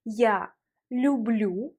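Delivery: я люблю slow, L in люблю leaned on; stressing the L. Shown as a demonstration. Teacher points out the added L, slow and deliberate. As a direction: draw out люблю to show the L. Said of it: "on voit une consonne L".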